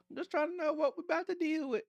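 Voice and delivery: high-pitched mock Southern accent; imitation Southern accent. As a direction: put on a bad Southern accent. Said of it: "that was my imitation Southern accent".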